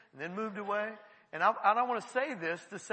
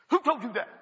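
loud, higher-pitched indignant demand; mad; 3 semitones higher, 8 dB louder than usual. Retold or imitated. Man confronted about his secret, mad outburst. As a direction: burst out as the angry man. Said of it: "he got mad at me".

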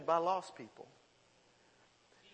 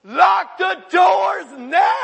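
panicked full-force shout, drawn out; top-of-lungs scream; 9 semitones higher, 13 dB louder, much slower than usual. Acted. Dad whose son is missing in the store, panicked scream. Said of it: "wanted to scream at the top of my lungs".

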